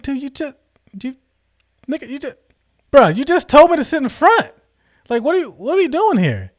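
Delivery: stammer, then burst out in baffled protest; acted-out exasperated confusion, pitched high; incredulous protest. Acts out baffled protest to driver.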